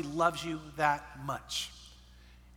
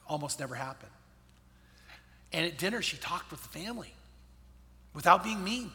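normal talking voice

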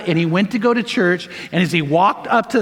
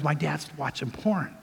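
hushed shock; 4 semitones lower, 11 dB quieter than usual. Retold or imitated. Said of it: "he goes".

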